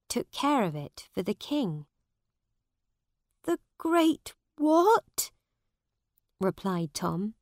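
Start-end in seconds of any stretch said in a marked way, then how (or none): 3.4-5.3 much higher, slow, rising in confusion; a bewildered boy asks back; puzzled Tom. A narrator's reading. Narrator voices puzzled Tom.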